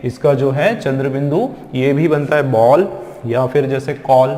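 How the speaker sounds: ordinary talk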